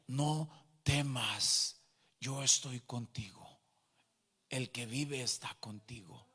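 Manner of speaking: very slow, low reassurance sinking to a whisper; robed heavenly figure. Retold or imitated. Robed figure promising to stay with the convert, slow hushed comfort. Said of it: "y me decía".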